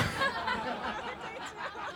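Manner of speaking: soft, high, real giggling; trailing laughter. Real, not acted. Panel reacting to a date joke, soft high laughter. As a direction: let the laugh run on quietly.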